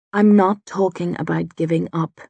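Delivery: usual speaking voice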